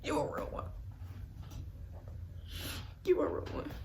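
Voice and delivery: hushed, breath-only thanks; whispered heartfelt praise; grateful whisper. Retold or imitated. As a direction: whisper it to someone who saved you. Retold thanks to the counselor.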